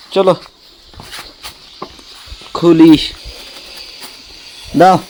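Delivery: ordinary talk, nothing marked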